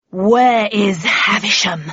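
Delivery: loud, slow booming demand; thunderous bellow. A narrator's reading. Red Queen storms in hunting Havisham, thunderous bellow. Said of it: "bellowed a voice like thunder".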